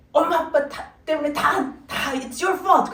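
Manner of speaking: imitating a blaming daughter; mock teen blame. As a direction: whine it's all your fault like a preteen. Daughter voiced blaming parents.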